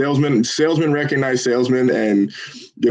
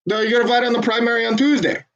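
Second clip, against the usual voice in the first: imitating a Jamaican caller, higher pitched; Jamaican accent impression; 10 semitones higher than usual. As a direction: mimic the Jamaican lady's accent. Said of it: "I tried to impersonate her voice".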